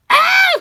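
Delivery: sudden high shriek of fright; startled scream. Real, not acted. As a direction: scream in shock. Scared by a skull-faced cover.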